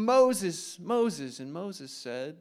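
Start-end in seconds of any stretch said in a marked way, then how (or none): 0.0-1.4 louder, higher call of the name. Acted. God calling Moses from the burning bush, a ringing call. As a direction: call his name across the distance.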